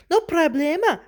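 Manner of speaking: high, loud little-dog voice; Poquito Tito. A narrator's reading. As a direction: pipe up brightly with Spanish flavour. Little dog reassures the kitten.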